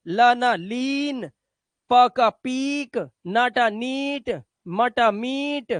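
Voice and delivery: short then long vowel, slowly; slow contrast pairs. Shown as a demonstration. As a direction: stretch the long ई in लीन, पीक, नीट, मीट. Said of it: "बड़ी की मात्रा लगाओ".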